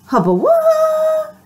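high, slow stunned hubba wah; amazed squeal. Acted. Pigeon stunned when the duckling offers the cookie. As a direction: gasp it out in delight.

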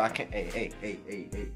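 usual speaking voice

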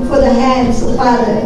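ordinary talk, nothing marked

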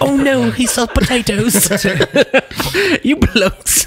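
high, drawn-out mock British voice; laughing, hammed-up British accent. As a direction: play a silly high-pitched Brit. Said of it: "Everything will be done in British accents".

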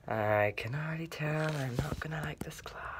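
breathy whisper to self; despairing whispered aside. Acted. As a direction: mutter it so nobody hears. Muttered aside.

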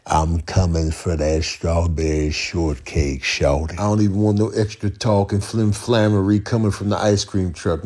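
ordinary talk

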